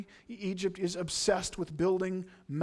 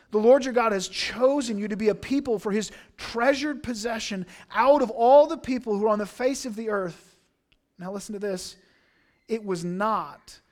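raised, louder solemn reading of Deuteronomy; fervent scripture proclamation; 3 semitones higher, 5 dB louder than usual. Acted. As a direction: proclaim the verse with conviction. Reads scripture aloud.

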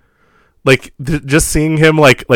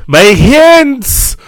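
high loud dramatic wail; anguished movie cry; 13 semitones higher, 6 dB louder than usual. Acted. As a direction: cry it like a film hero. Quoting the film.

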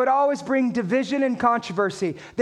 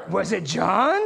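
unsure question, JOHN rising at the end; 6 semitones lower, slower than usual. Retold or imitated. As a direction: lift JOHN into a tentative question. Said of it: "said that like it was a question".

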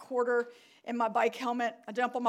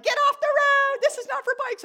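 high, loud angry heckle; honking drivers' yell; 9 dB louder than usual. Retold or imitated. Drivers yelling at a cyclist on the highway, angry shout. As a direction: yell it like an irate motorist. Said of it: "people are honking".

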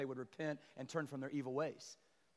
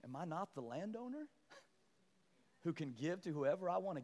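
softer, patient reply from God; a quieter rebuke to Jonah; God answering; 4 dB quieter than usual. Retold or imitated. God answers Jonah's complaint, softer and patient. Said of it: "God's going".

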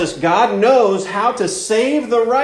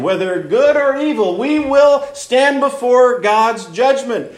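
fervent preaching; 5 semitones higher than usual. Acted. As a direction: preach it with rising conviction. Rising preaching on God as judge.

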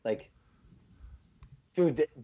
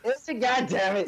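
loud, higher-pitched mock actor outburst; Gary Busey; 9 semitones higher, 4 dB louder than usual. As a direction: do a hammed-up manic Californian actor. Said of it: "That's my version of California".

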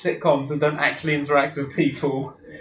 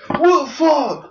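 high, slow mock freak-out; hammed-up fake-prank victim; fake shock; 12 semitones higher, 5 dB louder, much slower than usual. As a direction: overact the waking victim's shock. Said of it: "the mate just starts going".

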